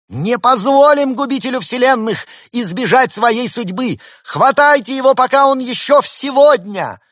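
high, loud rabble-rousing shout; rally the crowd to seize the fugitive; battle cry. A narrator's reading. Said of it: "Суслик испустил боевой клич".

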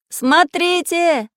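high, drawn-out excited shout; sudden shout. A narrator's reading. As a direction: suddenly cry out to draw attention. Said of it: "вдруг закричал Самоделкин". (внезапный протяжный крик)

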